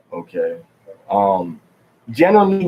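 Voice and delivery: normal talking voice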